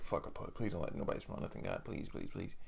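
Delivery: hushed, breathless pleading whisper; desperate inner prayer. Retold or imitated. Whispered plea to God while she walks behind him. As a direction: beg under the breath, barely voiced.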